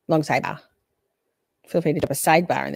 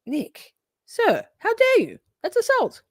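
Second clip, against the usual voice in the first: much higher, hammed-up offended shriek; mock outrage. Streamer pretending to be shocked by chat, high mock outrage. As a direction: play scandalised at the chatter.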